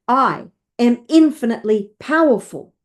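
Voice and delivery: firm, emphatic affirmation; with conviction. Shown as a demonstration. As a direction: say it like you mean it. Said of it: "Say it with conviction".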